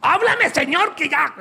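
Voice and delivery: hammed-up bitter believer barking at heaven; impatient demand. Creyente amargado exige a Dios que hable ya, gritando en broma. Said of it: "Usted enojado".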